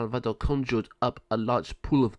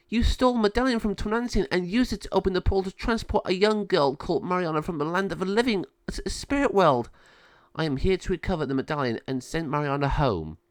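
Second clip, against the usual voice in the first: higher-voiced accuser laying out the charge; firm, higher voice of a righteous sister; stern Lama Sabio; 8 semitones higher than usual. Lama Sabio confronting Malvado over the medallion, stern.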